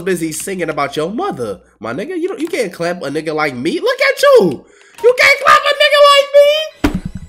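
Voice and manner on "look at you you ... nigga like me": high mocking taunt.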